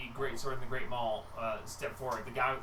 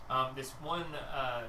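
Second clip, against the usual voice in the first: ordinary delivery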